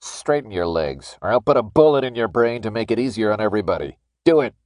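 gun-to-the-head command, building in force; menacing threat. A narrator's reading. Guard threatens at gunpoint.

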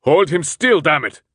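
high, loud angry shout; frustrated shouted order. Acted. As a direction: curse at them to hold on. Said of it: "Hans shouted".

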